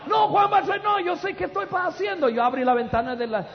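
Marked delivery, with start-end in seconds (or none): 0.1-2.3 hurried insistence. Retold or imitated. Husband waving off his worried wife, high rushed insistence. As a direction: brush her off, sure you know what you're doing. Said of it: "Todo apurado".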